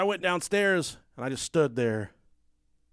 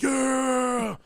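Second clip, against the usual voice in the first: high, loud yelled yeah; cheering yell; 9 semitones higher, 4 dB louder than usual. Retold or imitated. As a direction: whoop it out in triumph. Friend cheers as he escapes.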